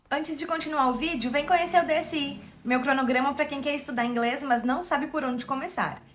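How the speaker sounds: quick, hushed promo read. Acted. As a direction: rattle off the sponsor plug quickly. Ad read inserted in the video. (leitura rápida de anúncio)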